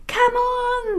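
high calling come on; urging the lion to jump; shouting. Acted. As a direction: call out across the water. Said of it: "they shouted".

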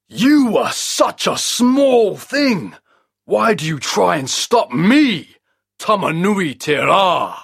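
high, slow booming giant voice; a huge sun scoffing at a tiny man; the trapped sun scorning Maui; sun god voice. A narrator's reading.

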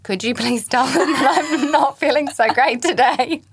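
pleading while giggling; fake-sick request, breaking into laughter; half-laughed excuse to a friend; laughing mock plea. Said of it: "You could say to a friend".

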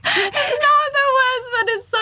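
high, laughing through the words. Real, not acted. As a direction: squeal it out laughing. Laughing hard mid-sentence.

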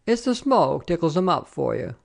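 different voice, casual reassurance; offhand soldier. A narrator's reading. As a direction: brush it off like a rough comrade. Soldier excuses crying comrade.

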